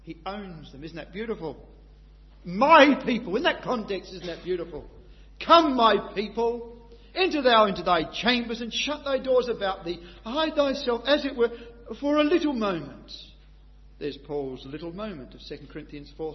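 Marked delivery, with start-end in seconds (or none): 2.4-4.9 loud, high preaching, building with fervour; full-force preaching. Acted. 5.4-6.9 "Come" drawn out, loud and high; slow loud call. Acted. 7.1-13.1 loud, high preaching, building with fervour; full-force preaching. Acted.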